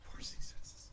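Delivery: hushed whisper to oneself. Real, not acted. Game master echoing a dice roll, whispered. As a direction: repeat it under your breath, whispering. Echoes the roll under breath.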